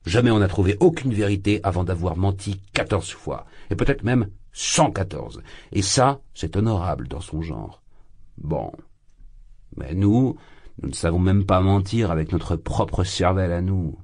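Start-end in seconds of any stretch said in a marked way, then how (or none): none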